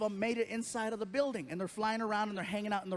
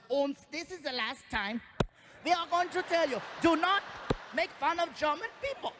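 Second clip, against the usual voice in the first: put-on German accent warning; 6 semitones higher than usual. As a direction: warn them, heavy German accent.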